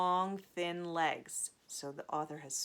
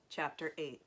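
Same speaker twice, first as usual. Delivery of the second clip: ordinary delivery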